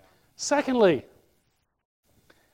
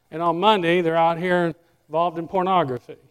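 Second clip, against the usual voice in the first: ordinary talk, nothing marked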